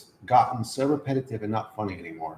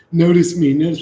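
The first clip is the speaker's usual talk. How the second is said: mock needy whine; 7 semitones higher, 8 dB louder than usual. Mocking reality begging for attention.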